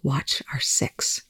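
slow, hushed order; near-whispered command, drawn out; said quietly. A narrator's reading. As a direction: give a soft, breathy warning. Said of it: "Telford said quietly".

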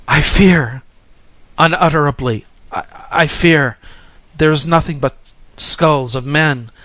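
high, loud cry of dread; shuddering fear. A narrator's reading. Pilgrim shuddering before the mountain of skulls, frightened cry. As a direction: cry out in horror, faltering on the repeat. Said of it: "The other, shuddering, repeated".

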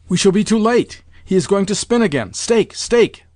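high, urgent exclamations; feverish excitement. A narrator's reading. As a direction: press him in a panic to bet now. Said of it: "was in a perfect fever".

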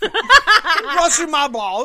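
laughing. Retold or imitated. Mocking quoted line, laughing.

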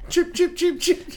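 very high squeaky chick peeps; baby chick peeps. Pretend. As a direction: chirp like a tiny chick. Joins chicken teasing.

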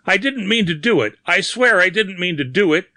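high, desperate protest; panicked pleading. A narrator's reading. Bearded man begging the unseen attacker, panicked pleading. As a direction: swear it frantically, pitched far up.

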